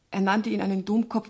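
normal talking voice

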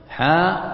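حاء with the vowel held two beats; natural madd. Shown as a demonstration. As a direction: stretch the long aa in حاء. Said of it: "ما تقول حاء وتقطعها بسرعة".

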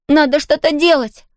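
high urgent plea; desperate. A narrator's reading. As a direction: call out in desperation. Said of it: "в отчаянии крикнул Том". (в отчаянии)

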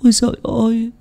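first "Ôi giời ơi" lament, high and stretched; near tears. A narrator's reading. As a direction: wail "Ôi giời ơi" like you're about to cry. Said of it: "nói như sắp khóc".

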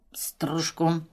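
put-on slurred, half-whispered drunk mumble; slurred speech. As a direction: slur it out quietly like a drunk. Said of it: "заплетающимся голосом подтверждает Пётр".

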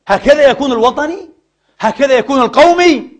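high and loud rhetorical challenge; indignant questions. Real, not acted. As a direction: fling the questions at him.

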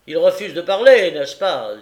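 loud, high challenge; peremptory and loud; irritated magistrate. A narrator's reading. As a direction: throw it out as an accusation.

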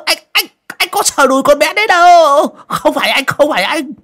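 very high, loud, stammering protest; panicked pleading. A narrator's reading. Coffin maker denying he groped the girl's thigh, in high-pitched panic. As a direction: babble denials in a squealing panic.

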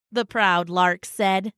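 ordinary delivery

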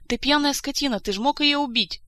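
high, loud furious accusation; angry shout, pitched well up; outraged yelling. Acted. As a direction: lay into someone at full voice.

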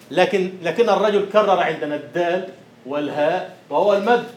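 ordinary delivery

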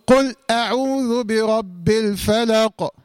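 slow recitation, final ق of "الفلق" bounced; correct qalqala. Shown as a demonstration. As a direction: let the ق of "الفلق" vibrate on its release. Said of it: "معنى القلقلة هو الاضطراب". (تلاوة متأنية مع اضطراب القاف في آخر "الفلق")

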